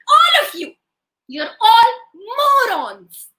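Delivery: high, loud call, dropping at the end; hammed-up indignant scolding; scolding wife. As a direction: tell off a crowd, hands on hips. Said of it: "hands on her side like this".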